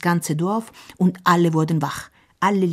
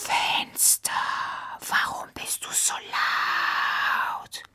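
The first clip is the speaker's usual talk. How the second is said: slow, soft whispered voice; an ancient tree murmuring; sleepy old oak; 7 dB quieter, slower than usual. Acted.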